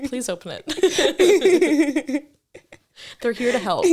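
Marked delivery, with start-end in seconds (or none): 0.1-3.2 slow, drawn-out laugh through the words. Pretend. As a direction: laugh while stretching it out.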